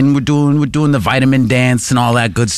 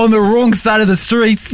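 high, hammed-up British tourist; mock Englishman's voice; British accent; 6 semitones higher than usual. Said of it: "Just like that".

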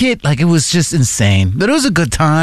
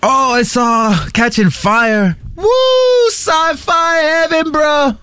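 excited; 11 semitones higher, much slower than usual. Real, not acted. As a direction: rave about the movie like a true fan. Gushing about a favorite movie.

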